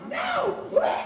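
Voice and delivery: high, soft, shocked squeak. Retold or imitated. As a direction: squeak it out in disbelief. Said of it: "she walks upstairs and is like".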